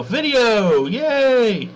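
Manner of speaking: high delighted little cheer; excited cheer. Real, not acted. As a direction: celebrate that it finally works. Video finally starts working.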